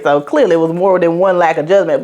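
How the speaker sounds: ordinary delivery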